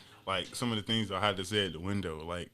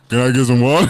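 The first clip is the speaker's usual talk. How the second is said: very loud barked order through laughter; shouted order; 19 dB louder than usual. Retold or imitated. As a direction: bellow it at the drive-thru speaker.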